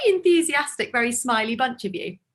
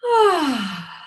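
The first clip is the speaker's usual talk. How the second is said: gentle voiced sigh; 3 semitones higher than usual. Shown as a demonstration. Teacher demonstrating to the choir, a gentle voiced sigh. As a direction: let the breath out on a gentle sigh. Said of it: "And it sounds like this".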